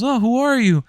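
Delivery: high confused question; squeaky thief. Retold or imitated. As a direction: play the goofy thief way up high. Thief voice still.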